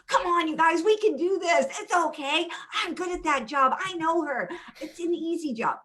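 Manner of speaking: high, rallying encouragement; upbeat pep talk. Retold or imitated. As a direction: perk up the team with cheerful energy. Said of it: "eye is like".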